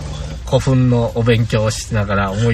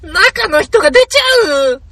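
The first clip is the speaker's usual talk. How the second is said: put-on very high, slow squeaky anime girl; high cartoon girl voice; 5 dB louder, slower than usual. Anime character catchphrase voice.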